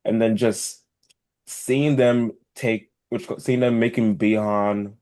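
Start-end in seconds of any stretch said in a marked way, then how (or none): none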